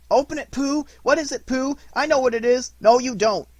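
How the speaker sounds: high, loud excited crowd voices; eager clamour. A narrator's reading. Everyone urging Pooh to open his present, high and loud. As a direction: shout over each other eagerly.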